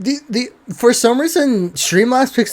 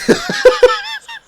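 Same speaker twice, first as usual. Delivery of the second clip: high squeaky laugh. Real, not acted.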